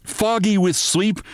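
ordinary delivery

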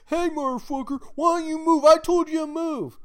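high, loud mock yelling; hollered order. Flunky ordering a supposed recruit to move, hollering. Low-ranking soldier shouting at officer.

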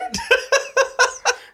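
squealing laugh, pitch shooting up; real high giggle fit; high burst of laughter. Real, not acted. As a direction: let the laugh break high. Laughing at own bird joke.

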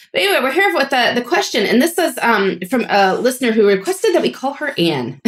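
ordinary talk, nothing marked

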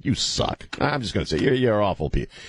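usual speaking voice

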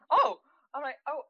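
high, loud startled "oh"; baffled. Retold or imitated. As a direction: retell a stunned high-pitched oh. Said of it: "baffled by what's happening in front of me".

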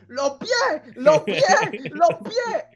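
high, loud panicked yelling; retold yelling. Friend yelling from the river about his feet. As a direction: yell it like he's being dragged under. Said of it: "él empieza a gritar".

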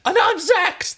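high, loud indignant insistence; wounded pride. Acted. Zax correcting Stark, with wounded pride. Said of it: "insisted with wounded pride".

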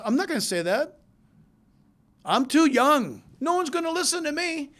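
high-pitched, hammed-up youthful objection; mock protesting teenager. Young Jeremiah objecting to God's call, mock protest. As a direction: push back like a reluctant kid, voice climbing. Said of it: "Jeremiah turns around with his mouth and says".

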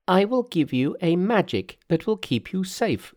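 high-pitched Monkey voice; reassuring Monkey. Acted. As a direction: promise protection in a high character voice.

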